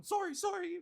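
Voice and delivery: high-pitched mock apology; apologetic bystander. Newcomer in the line joining the sorries, mock apologetic. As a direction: pipe up with a put-on, polite little sorry. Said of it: "The person behind me going".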